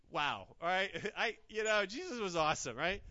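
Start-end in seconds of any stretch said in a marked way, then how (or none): none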